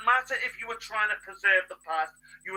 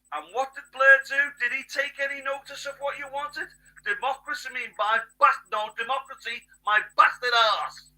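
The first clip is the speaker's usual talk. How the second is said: angry rant; 5 semitones higher, 9 dB louder than usual. Real, not acted. Angry political rant at voters.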